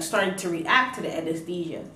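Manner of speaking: usual speaking voice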